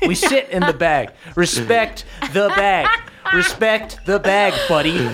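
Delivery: stern warning, slowing for the rule; scolding bag guardian. Acted. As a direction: lay down the law in character. Balnor scolds Bastion in bag.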